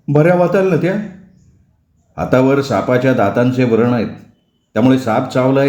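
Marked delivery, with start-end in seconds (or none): none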